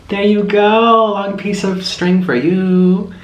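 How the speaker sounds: slow, tender pet talk; slow coaxing. Real, not acted. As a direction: soothe the bird while feeding it. Feeding the parrot.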